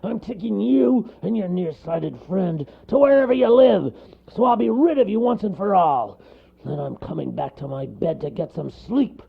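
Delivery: cross storybook bear; growling bear. A narrator's reading. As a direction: lay down the law gruffly. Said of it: "growled the bear".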